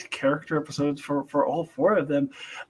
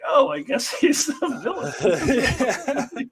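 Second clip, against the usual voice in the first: amused realization; 8 semitones higher, much slower than usual. Retold or imitated. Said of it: "I was like".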